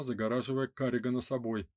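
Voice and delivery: usual speaking voice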